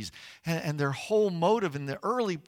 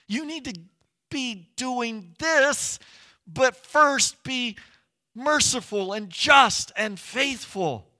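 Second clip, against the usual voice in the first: higher-pitched scolding, slowing for the final command; acted exasperated rebuke; 6 semitones higher, 5 dB louder than usual. Preacher paraphrasing Jesus to the Pharisees, exasperated rebuke. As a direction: take hypocrites to task, insistently.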